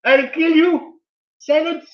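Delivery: high shrieking yell of an angry quizzer; mock furious yell. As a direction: scream it like a furious contestant. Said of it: "ripping into me said".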